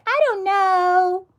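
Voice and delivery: high, slow, whiny mock of someone who doesn't know; high-pitched mock whine; mocking clueless voice. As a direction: squeak it out like a baffled student. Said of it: "if you're just like".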